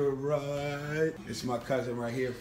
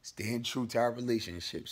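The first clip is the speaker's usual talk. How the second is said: normal talking voice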